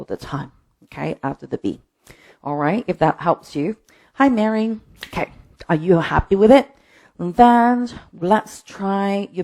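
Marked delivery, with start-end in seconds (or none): none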